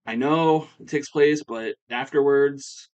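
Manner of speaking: a mock-emphatic objection, slowing on afterwards; insistent protest. Bayley turning down PD's Team Canada offer, loud insistent protest. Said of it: "And Bayley's like".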